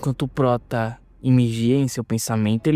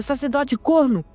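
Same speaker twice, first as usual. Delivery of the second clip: calling shout; 9 semitones higher, slower than usual. Acted. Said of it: "E o Prota grita".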